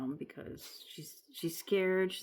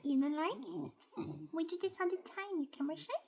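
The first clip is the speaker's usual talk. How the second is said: high cooing pet voice; baby talk; 12 semitones higher than usual. Acted. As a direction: tease the shy pet sweetly.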